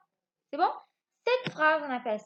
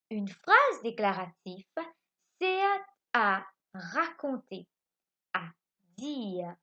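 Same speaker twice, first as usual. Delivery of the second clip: lecture lente; 2 semitones lower, slower than usual. Shown as a demonstration. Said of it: "Il dit".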